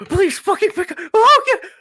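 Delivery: high, loud, panicked plea; desperate pleading. Acted. Friend lost in the woods begging someone to answer, desperate. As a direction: cry out in mounting desperation. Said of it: "him going".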